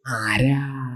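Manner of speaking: drawn-out voice from the beyond; otherworldly, lingering question; distant soul answering. Acted. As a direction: answer like a detached spirit, stretching the word. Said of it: "അവിടുന്ന് ചോദിച്ചു".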